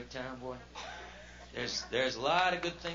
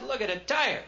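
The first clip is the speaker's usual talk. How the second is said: indignant complaint; 8 semitones higher, 4 dB louder than usual. Retold or imitated. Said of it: "I said".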